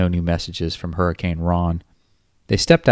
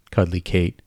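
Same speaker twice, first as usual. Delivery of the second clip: ordinary delivery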